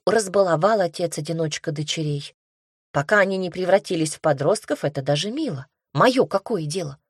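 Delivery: ordinary delivery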